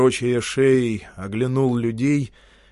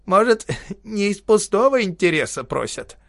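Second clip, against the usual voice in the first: put-on sly old man; 8 semitones higher than usual. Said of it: "усмехнулся остренькой усмешкой".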